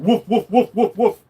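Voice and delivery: playful imitated dog barks; mock barking. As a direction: woof like a dog through a laugh. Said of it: "walking around going".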